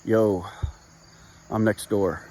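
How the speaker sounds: very low, toneless deadpan reply; imitated strange classmate. Odd classmate answering an offer of help, low deadpan. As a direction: drop the voice low and say it blankly. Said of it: "his reply, and I quote, was".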